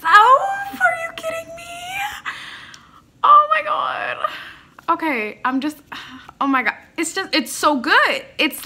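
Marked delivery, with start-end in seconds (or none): 0.0-4.2 very high, loud wailing awe; overwhelmed disbelief. Real, not acted. Fan amazed by the harmony with herself, wailing disbelief. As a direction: cry out, blown away.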